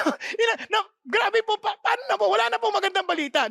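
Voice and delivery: high, shouted panic; mock despair of a doomed man; panicked. Preacher mimics panicked patient. (kunwaring takot na sigaw, mataas)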